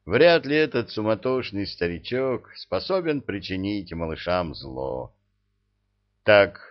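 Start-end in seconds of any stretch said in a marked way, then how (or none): 6.2-6.6 loud, decisive interruption. A narrator's reading. Man cutting short a brewing argument, decisive. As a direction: cut in firmly and loudly. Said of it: "решительно прервал он".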